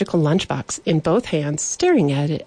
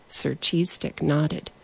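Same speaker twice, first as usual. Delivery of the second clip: ordinary delivery, nothing marked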